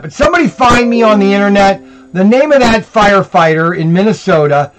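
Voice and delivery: usual speaking voice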